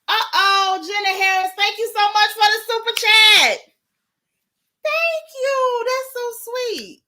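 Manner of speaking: high, loud thank-you to a donor; excited gratitude. Real, not acted. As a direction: gush over the super chat. Thanking super chat donor.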